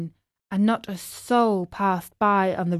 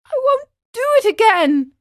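high, loud puppet wail; acted tearful pleading; tearful promise; 14 semitones higher, 5 dB louder, faster than usual. A narrator's reading. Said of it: "cried Pinocchio in tears".

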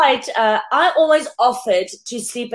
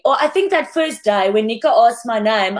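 ordinary talk, nothing marked

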